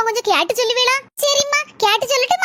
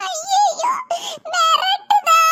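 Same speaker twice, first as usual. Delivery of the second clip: frightened whine; 7 semitones higher, much slower than usual. Acted. Boy frightened by threat.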